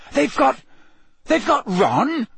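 high, halting shocked question; gasped shock. A narrator's reading. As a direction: break off, then blurt it in disbelief. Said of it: "Harry gasped".

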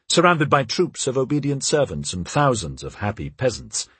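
usual speaking voice

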